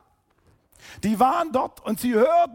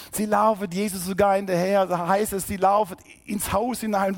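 normal talking voice

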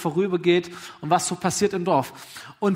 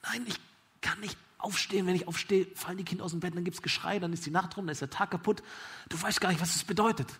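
quiet, weary excuses; hushed exasperation; 7 dB quieter than usual. Acted. Father explaining why he can't get up, hushed exasperation. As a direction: whisper the complaint.

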